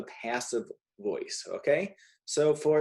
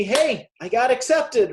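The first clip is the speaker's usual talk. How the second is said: cheery pretend announcement, higher and louder; mock happy news; 10 semitones higher, 6 dB louder than usual. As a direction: play an excited student sharing good news. Teacher acts out happy student news.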